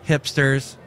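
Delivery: usual speaking voice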